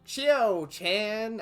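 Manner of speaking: southern voice. Shown as a demonstration. Showing the accent from the anime's English dub, mock Southern. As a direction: try for a Southern twang on Chio-chan. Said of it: "let's see if I can do the, the southern voice".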